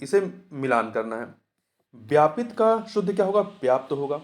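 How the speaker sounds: ordinary delivery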